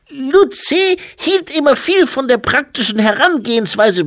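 very high, loud infant voice; squeaky toddler speechifying loudly; baby-like abbot. A narrator's reading. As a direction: play a dignified abbot in a baby's piping voice.

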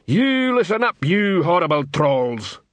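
high-pitched, pompous troll command; troll drill sergeant. A narrator's reading. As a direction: address the recruits as a self-important troll. Troll drill sergeant addresses recruits.

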